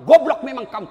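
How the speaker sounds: loud scolding shout; officer cursing a late subordinate, loud. Retold or imitated. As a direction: yell the insult. Retold cursing at a late soldier.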